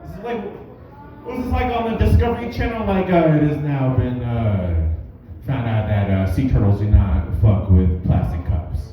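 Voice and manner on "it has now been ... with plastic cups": much lower, deep nature-show narrator voice; documentary narrator. Retold or imitated. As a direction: parody a solemn Discovery Channel announcer. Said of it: "It's a direct quote".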